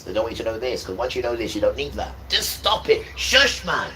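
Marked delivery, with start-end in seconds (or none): 2.3-3.9 sharp shout. Real, not acted. Preacher scolds distractors.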